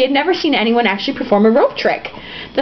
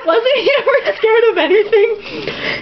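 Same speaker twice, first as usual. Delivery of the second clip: slow, high, laughing through it; laughing question; 11 semitones higher, slower than usual. Real, not acted. Reader laughing at the hero, amused. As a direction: ask it while giggling.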